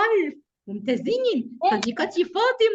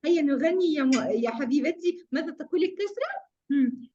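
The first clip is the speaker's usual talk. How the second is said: normal talking voice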